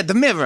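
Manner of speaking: "mirror" with a V, dropped final R; mirror as MIV-ra. Shown as a demonstration. Hosts teasing regional speech, "mirror" said as "mivra". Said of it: "There's a V in mirror".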